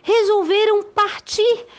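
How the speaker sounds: lively storybook narration; reading to children. A narrator's reading. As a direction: tell it like a bedtime tale.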